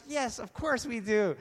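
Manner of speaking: high, loud, drawn-out agreement; excited crowd reply. Acted.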